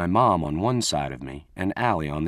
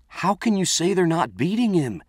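pleading; 9 semitones higher than usual. Acted. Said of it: "I pleaded with her".

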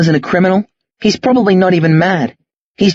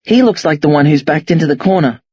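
ordinary delivery, nothing marked

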